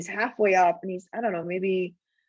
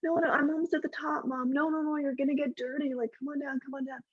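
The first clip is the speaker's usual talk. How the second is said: higher, sweet coaxing mom voice; mom pleading; 6 semitones higher than usual. Retold or imitated. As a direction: coax the kid down like an embarrassed mom. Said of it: "you can hear mom".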